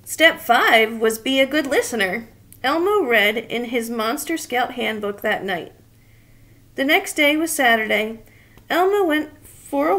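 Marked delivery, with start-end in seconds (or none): none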